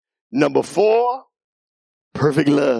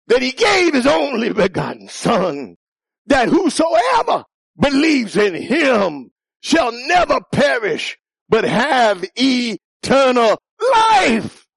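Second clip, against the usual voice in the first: full-force preaching; 10 semitones higher, slower than usual. Real, not acted. Preacher proclaiming God's love to the youth, shouting. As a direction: preach it to the rafters.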